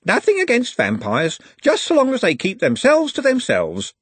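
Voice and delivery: a busybody with firm views; lightly voiced prim old lady; gossipy macaroon lady. A narrator's reading. Macaroon lady's opinion.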